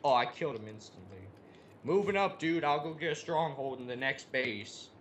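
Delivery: ordinary talk, nothing marked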